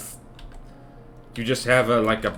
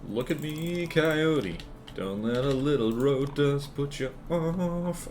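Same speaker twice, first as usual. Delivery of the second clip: soft teasing voice to the coyote; mock taunt; 3 semitones higher, 6 dB quieter, slower than usual. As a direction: coo a lure at the coyote. Addressing coyote, in-game jab.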